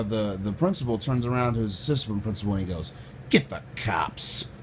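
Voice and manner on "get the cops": low, slow, drawn-out order; deep, deliberate put-on authority voice; Dave Chappelle-style impression. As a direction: drop the pitch and stretch each word. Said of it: "Just Dave Chappelle and shit".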